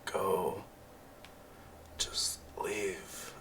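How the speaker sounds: faint, halting, near-whisper; weak, breathy last urging; dying plea. Acted. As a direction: force out each phrase with long pauses. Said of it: "she just says".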